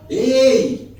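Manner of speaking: high, loud shout from afar; shouted call. Retold or imitated. Drunk calling across the road, shouting. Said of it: "O bêbado gritou do outro lado".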